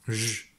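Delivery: "J." as a bare zh, not the letter name; isolated zh sound. Shown as a demonstration. Teacher explaining the sound in brackets, isolated zh. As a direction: hold the consonant "J." as a lone zh. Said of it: "ça veut dire le son".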